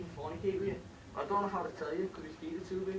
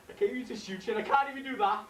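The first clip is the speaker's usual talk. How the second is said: crying; 7 semitones higher, 7 dB louder than usual. Acted. Mickey to Eddie, failing to shoot him, crying. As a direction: voice breaking into tears. Breaking down holding the gun.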